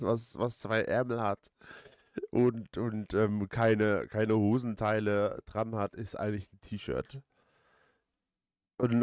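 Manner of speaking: ordinary delivery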